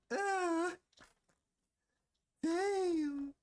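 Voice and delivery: high mock wail of despair; hammed-up cry of despair. Mock crying over bad habits.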